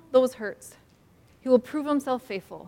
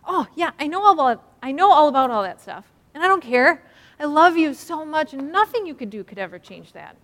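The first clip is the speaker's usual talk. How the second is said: loving reassurance; 5 semitones higher, 4 dB louder than usual. Pretend. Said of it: "I stop and I'm like".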